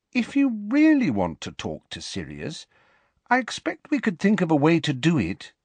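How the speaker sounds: put-on high-pitched girl thinking it through; Ginny, tentative. A narrator's reading. Ginny offering Harry a way to reach Sirius, tentative. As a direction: offer help in a high girl's voice. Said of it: "said Ginny slowly".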